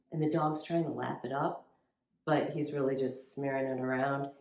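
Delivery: normal talking voice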